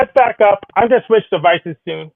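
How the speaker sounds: ordinary delivery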